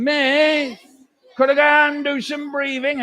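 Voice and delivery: put-on a whiny kid begging the teacher. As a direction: beg like a whingeing pupil. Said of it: "a whiny kid going".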